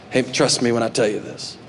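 lightly voiced wise old mentor; old salty dog. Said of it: "the old salty dog goes".

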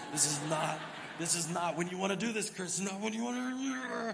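loud, pleading self-rebuke at Chris; venting at yourself under the truck; rising frustrated shout, slowing at the end; exasperated outburst. Retold or imitated. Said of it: "And I'm going".

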